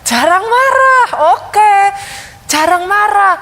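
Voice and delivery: imitating a teacher's happy approval. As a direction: react like a pleased teacher, stretching the words. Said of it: "kakak gurunya happy dong".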